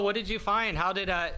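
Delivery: ordinary delivery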